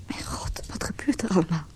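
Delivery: breathless frightened whisper; hushed despair; whispered dread. Acted. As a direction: barely voice the fear. Anxious wait in hospital.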